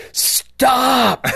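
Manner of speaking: sudden high loud yell; yelling stop. Retold or imitated. Husband yelling at wife mid-dream, sudden loud yell. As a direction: shout it out to cut her off. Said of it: "Like, I yell out".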